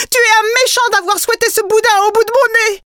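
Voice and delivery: high, loud, fast scolding; angry reproach. A narrator's reading. Wife furious at her husband, scolding high and fast. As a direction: lash out at him, quick and loud.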